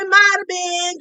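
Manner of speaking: lightly voiced sheepish boyfriend; imitating Keith. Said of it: "just like that".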